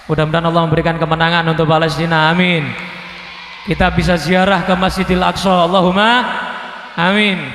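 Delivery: loud and fast prayer to the crowd; rousing sermon prayer, fast and loud; fervent prayer. Real, not acted. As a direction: pray out loud with force. Preacher prays fervently for Palestine.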